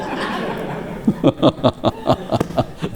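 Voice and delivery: quiet airy chuckle; soft breathy laugh. Real, not acted. Preacher laughing at his own monkey joke, soft laugh. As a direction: let a soft laugh slip out.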